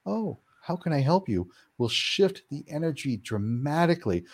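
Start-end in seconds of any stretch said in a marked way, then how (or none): 0.1-1.5 higher, softer, gentle offer; compassionate. Retold or imitated. New attitude toward the spirit: soft, compassionate. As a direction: ask it kindly, with care.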